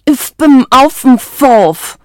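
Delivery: loud, high, muffled outburst; excited, beak tied shut. A narrator's reading. Isabella with her beak bound, muffled and excited. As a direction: push it excitedly through a closed mouth. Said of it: "sagte sie aufgeregt".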